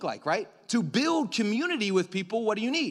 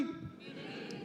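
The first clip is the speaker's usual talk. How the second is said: congregation answering; 17 dB quieter than usual. Real, not acted. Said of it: "Everybody say community".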